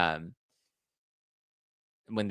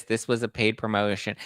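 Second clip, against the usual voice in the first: normal talking voice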